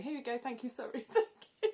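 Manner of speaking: overpolite, laughing through it; chirpy thanks. Retold or imitated. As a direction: gush politely as you pass the dish. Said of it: "they're going".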